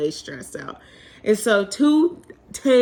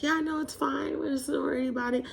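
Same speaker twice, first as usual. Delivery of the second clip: lady's reply; 6 semitones higher, 8 dB quieter, faster than usual. Retold or imitated. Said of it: "The lady's like".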